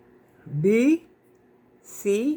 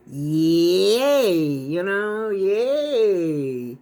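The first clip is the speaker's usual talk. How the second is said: excited cheer; 4 dB louder, much slower than usual. Real, not acted. Cheering laundry is all done.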